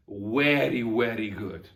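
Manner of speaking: "very" said weddy, with a w. Shown as a demonstration. Teacher repeating the memory trick, w-sounded very. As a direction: round the lips to start each "very". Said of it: "would have said the letter W".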